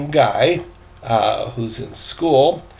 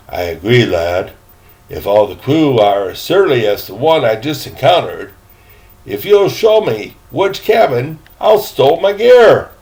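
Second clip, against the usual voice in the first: put-on a fussy, louder old gentleman's voice; proper old gentleman; 7 dB louder than usual. Gentleman asking the boy about his cabin, fussy and formal. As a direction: sound like a prim elderly traveller. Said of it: "said the elderly gentleman".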